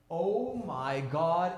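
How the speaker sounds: very drawn-out, stunned oh my God; slow-motion awe; awestruck relief. Retold or imitated. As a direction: stretch every word out in amazement. Retold amazement, pain gone.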